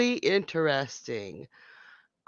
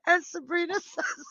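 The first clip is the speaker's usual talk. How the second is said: normal talking voice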